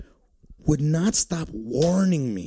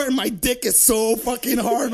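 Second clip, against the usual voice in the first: usual speaking voice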